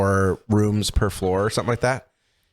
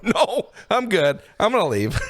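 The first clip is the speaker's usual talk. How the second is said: nervous laugh; 10 semitones higher than usual. Retold or imitated. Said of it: "I was like".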